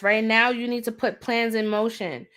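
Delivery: usual speaking voice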